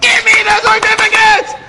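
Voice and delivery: high-pitched mock shriek; screaming. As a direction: shriek the demand like a frantic clerk. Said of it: "screaming at the top of her lungs".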